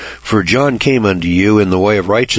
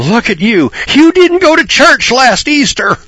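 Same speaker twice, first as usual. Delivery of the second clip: much higher, louder, slow scolding mimic; mocking accuser; 5 dB louder than usual. Preacher mocks religious people scolding about Easter. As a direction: wag a finger like a smug churchgoer. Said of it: "they're pointing their fingers and they're saying".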